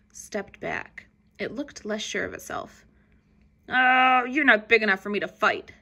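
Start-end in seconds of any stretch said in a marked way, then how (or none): 3.7-5.7 loud dismissive grumpy bug; grouchy ladybug. A narrator's reading. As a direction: scoff and back out. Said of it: "it said".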